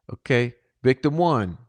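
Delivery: ordinary delivery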